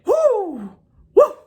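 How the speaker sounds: high whooping cheer; excited woo. Real, not acted. Fan cheering as the island blows up, high excited whoop. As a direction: whoop it up with real joy.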